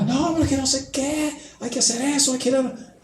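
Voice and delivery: high, strained nagging mother; yelling mother. Retold or imitated. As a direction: play the mother scolding her son. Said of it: "hablaba así con gritos".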